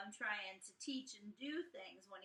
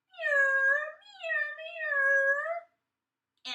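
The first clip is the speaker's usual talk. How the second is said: high, loud, spiteful yowling meows; nasty cat meow; 14 dB louder, much slower than usual. Acted. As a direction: yowl it out like a nasty alley cat. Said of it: "in a nasty, spiteful way".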